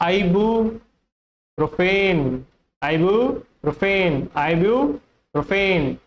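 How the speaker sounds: "ibuprofen" broken as eye-byoo, pro-fen; correct pronunciation. Shown as a demonstration. Teacher models the medicine's correct name, slowly pronounced. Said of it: "इसका correct pronunciation होता है".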